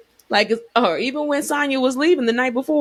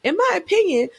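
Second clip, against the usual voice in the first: heated rant; 9 semitones higher than usual. Real, not acted. Calling out Marlo's pettiness.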